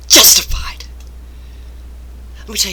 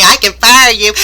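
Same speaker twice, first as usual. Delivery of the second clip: smug threat; 4 semitones higher, 10 dB louder than usual. Retold or imitated. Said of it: "and say".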